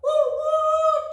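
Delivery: high, loud hooting woo; train whistle. Pretend. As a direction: hoot like a steam train.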